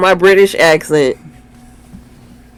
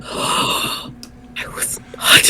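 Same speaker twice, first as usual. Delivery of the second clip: gasping whisper; 10 dB quieter, much slower than usual. Real, not acted. Denying premiering age, gasping.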